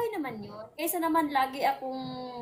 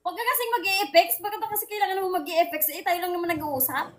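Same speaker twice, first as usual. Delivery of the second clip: galit; 4 semitones higher than usual. Real, not acted. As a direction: scold a partner in exasperation. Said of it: "Bakit ka galit?". (naiinis na paninita)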